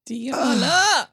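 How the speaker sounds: high, loud, moved outburst of the name; emotional cry of a name. Real, not acted. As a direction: call her name, overcome with feeling. Host moved by listener story.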